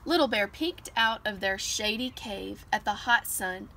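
plain reading voice

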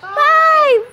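loud high counting; new year countdown crowd. Real, not acted. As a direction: shout out the countdown. Shouting the countdown.

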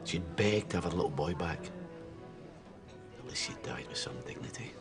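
very low, hushed bitterness; quiet bitter reproach. Acted. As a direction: condemn your son under your breath, heavy with hurt. Grieving father rebukes silent son.

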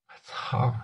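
breathy, voiceless swear under the breath; muttered curse. Acted. Boss cursing quietly after hearing the lie, muttered. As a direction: curse to yourself, barely audible.

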